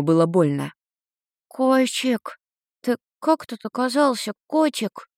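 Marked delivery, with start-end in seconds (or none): 1.5-5.1 put-on high, lisping little boy; slight lisp. A narrator's reading. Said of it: "немного шепелявый голос какого-то мальчишки".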